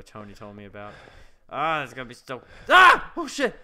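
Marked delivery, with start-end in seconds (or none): 2.7-3.6 scream. Real, not acted. Jump scare in horror game.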